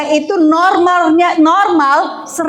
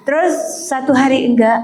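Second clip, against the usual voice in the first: ordinary talk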